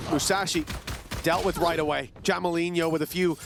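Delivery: ordinary delivery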